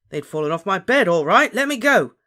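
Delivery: loud, climbing to a high yell; yell, building to a demand; angry shout. A narrator's reading. Dangling Ron demands release, angry shout. Dangling friend yells back.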